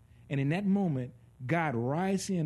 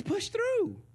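God urging; 10 semitones higher, slower than usual. Retold or imitated. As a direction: push it out high and strong, stretching the last words. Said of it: "But God says".

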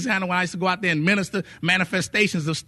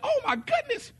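surprised wife; 6 dB quieter than usual. Retold or imitated. Wife discovers healing, retold.

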